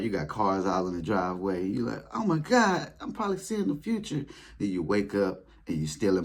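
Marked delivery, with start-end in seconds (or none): 2.1-4.2 high, wide-eyed wonder; imitating dazzled awe; amazed gasp. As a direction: gush like you just stepped into the future. Dreaming of a rich future.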